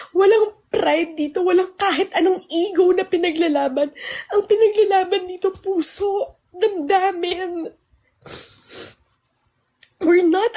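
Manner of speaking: 高く張り詰めた声で泣きそうに訴える; pleading on the edge of crying, pitched high; voice breaking with tears. Real, not acted. Tearful rant to former friends.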